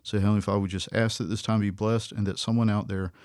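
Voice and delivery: soft, low prayer voice. Acted. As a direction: pray gently to the Father.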